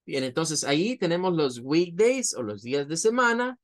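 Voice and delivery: normal talking voice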